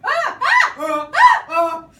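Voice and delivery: loud high repeated screams; panicked screaming in bursts; George screaming. Acted. George screaming back at the platypus. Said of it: "George the Englishman screamed".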